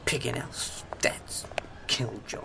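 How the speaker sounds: breathy whispered grumble under his breath; whispered aside. Acted. Drugged man whispering a sulky aside about his friend. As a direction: whisper it so his mate won't hear.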